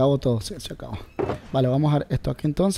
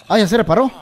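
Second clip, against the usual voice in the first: excited; 6 semitones higher, 6 dB louder than usual. Real, not acted. Game fixes, sudden joy.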